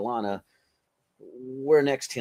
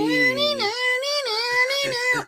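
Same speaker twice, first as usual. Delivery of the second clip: loud squealing laugh, much higher; high-pitched laugh; 5 dB louder than usual. Real, not acted. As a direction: burst into a high giggle. Laughing at the slogan.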